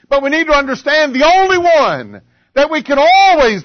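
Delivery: high, forceful pulpit delivery; impassioned preaching. Acted. Preacher to congregation about friends who let you down, impassioned. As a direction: hammer the key words, drawing them out.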